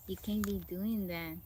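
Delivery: normal talking voice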